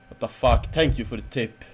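ordinary delivery

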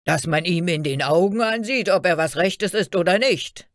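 loud guard, pitch climbing to the end; shouting. A narrator's reading. Guard inspects the timid newcomer, shouting. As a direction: keep bellowing the inspection order. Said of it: "schrie er".

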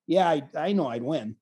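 low, soft, unbothered; lightly voiced calm confidence; laid-back. Remembering the wrestler's answer, low and laid-back. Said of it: "I will remember Jason Nolf going".

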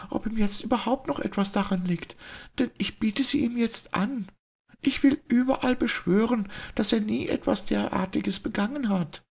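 a brave lady speaking up in public; clear, trembling voice. A narrator's reading. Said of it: "mit jener klaren, zitternden Stimme".

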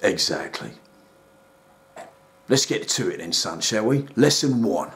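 a deep, calm therapist talking down; deep soothing counsellor; rehab counsellor. Acted.

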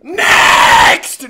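high, very loud drawn-out yell; exasperated shout. Real, not acted. Gamer fleeing a lobby that recognised him, exasperated shout. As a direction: holler it to cut things off.

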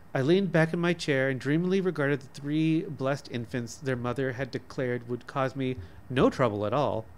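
ordinary delivery

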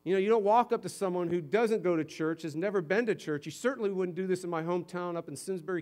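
normal talking voice

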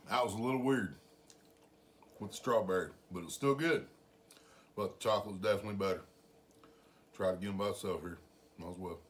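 talking between spoonfuls; chewing while speaking; mouth full of ice cream. Real, not acted.

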